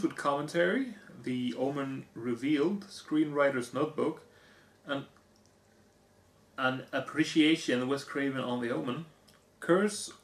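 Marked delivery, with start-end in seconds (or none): none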